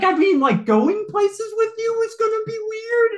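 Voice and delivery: can't believe it, half laughing; incredulous. Retold or imitated. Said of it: "I'm just like".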